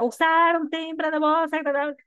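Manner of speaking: loud, high-pitched shouting demo; shouted reading. Shown as a demonstration. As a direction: read it out as a yell, far too loud. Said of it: "Tampoco puedo hacerlo grito".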